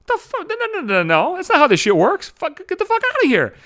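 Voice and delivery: high, loud outraged ranting. Real, not acted. Ranting at a friend who teased a story, loud and high. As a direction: fling it back in disbelief, loud and high.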